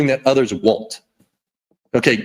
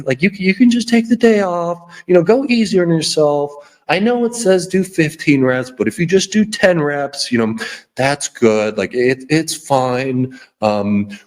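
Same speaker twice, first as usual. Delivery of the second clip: soothing mommy voice. Retold or imitated. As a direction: coo like a mom letting you off easy. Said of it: "So that's your mommy voice".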